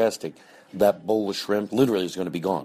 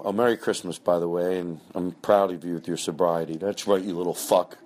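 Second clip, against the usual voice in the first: ordinary talk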